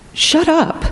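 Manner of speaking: high, shocked retort; a high, startled snap of shut up; stunned shut up. Retold or imitated. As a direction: blurt it out in disbelief. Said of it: "I, I literally said that".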